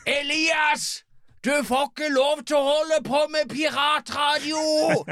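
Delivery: very high, shouted cartoonish scolding; hammed-up high-pitched telling-off; Elias Reikefisker. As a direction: shriek it as an outraged character, dragging the last word. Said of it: "ta den som Elias Reikefisker".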